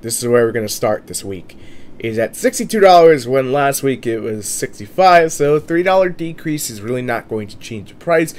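ordinary talk

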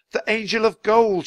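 high, loud exclamation of discovery; shout. A narrator's reading. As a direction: shout out what you've spotted. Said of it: "shouted Jack".

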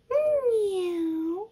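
high, long drawn-out meow; cat meow. Pretend. Teacher voicing something hidden in the jar, high meow. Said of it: "There's something meowing in the jar".

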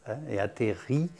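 ordinary delivery

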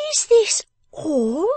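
very high, loud, slow demand; sharp demand. A narrator's reading. Miss Wren faces a small heap of coins, demanding and high. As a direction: draw out the question as a high-voiced little scold. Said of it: "demanded the person of the house".

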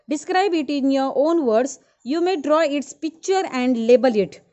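calling out loud and fast to the class; loud dictation. Real, not acted. Teacher calling the homework out loudly to the class. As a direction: project it so every student hears.

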